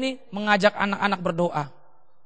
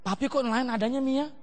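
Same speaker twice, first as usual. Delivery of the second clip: imitated child; 9 dB quieter than usual. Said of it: "Kalau saya nyanyi mereka".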